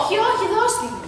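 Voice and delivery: no arguing, hand it over; insisting. Acted.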